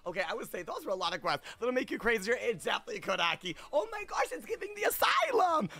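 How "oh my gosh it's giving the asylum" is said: high-pitched squealed exclamation; shrill-pitched dramatic realization; mock horror. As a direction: cry out high in playful alarm. Mock horror at asylum memory.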